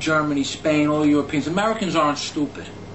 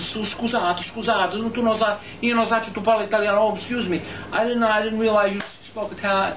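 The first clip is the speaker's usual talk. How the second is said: hurried, higher-pitched waiter backtracking; flustered apology; 5 semitones higher than usual. Retold or imitated. Waiter caught insulting an Italian speaker, flustered apology. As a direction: stammer out a panicked mock apology.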